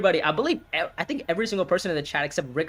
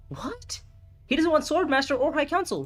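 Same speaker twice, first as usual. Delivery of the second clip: surprised; 11 semitones higher than usual. Real, not acted. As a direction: squeak it out in shock. Stunned by opponent's move.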